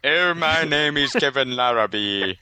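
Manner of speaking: slow, hammed-up upper-class British accent; mock British accent. Teasing his co-host in a slow fake British accent. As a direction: drag out a posh British introduction, slowly. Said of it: "parodying the British accent".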